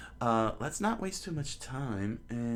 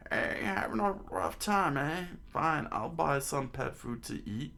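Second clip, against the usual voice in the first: put-on high-pitched old-timer voice; old man; 4 semitones higher than usual. Old man offers to buy pet food, high voice.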